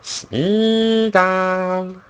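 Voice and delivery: high, drawn-out creature call; thin, held creature whine; Smeagol. Acted. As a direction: stretch the name out in a strained high voice. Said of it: "It really strains my voice".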